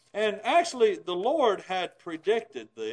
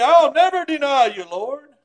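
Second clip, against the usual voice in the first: loud, high, bold declaration; bold vow; 5 semitones higher, 12 dB louder than usual. Retold or imitated. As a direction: proclaim it boldly, almost shouting. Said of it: "so boldly".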